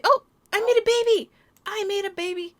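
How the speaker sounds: high, loud delighted cry; high thrilled announcement; excited. Real, not acted. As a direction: burst out with the happy news. Joyful egg discovery.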